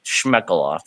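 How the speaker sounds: normal talking voice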